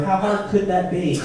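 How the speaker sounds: usual speaking voice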